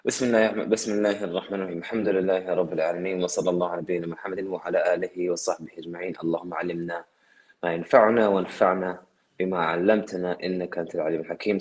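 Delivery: low, rapid Arabic recitation; Arabic opening prayer. Real, not acted. Speaker opening his answer with praise, rapid low recitation. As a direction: recite a formulaic invocation quickly, low.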